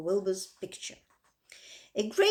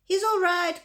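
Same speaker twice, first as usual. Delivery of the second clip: higher and louder, anxious cry; cried out; 8 semitones higher, 6 dB louder than usual. A narrator's reading. Zuckerman crying out to the announcer, anxious and loud. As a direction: cry out to calm the crowd. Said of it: "cried Mister Zuckerman".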